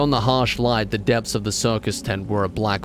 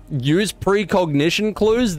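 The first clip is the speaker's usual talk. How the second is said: sarcastic; 8 semitones higher, slower than usual. Real, not acted.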